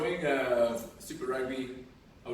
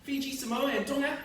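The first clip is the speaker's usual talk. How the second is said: put-on Kiwi accent; 8 semitones higher than usual. Continues high Kiwi voice.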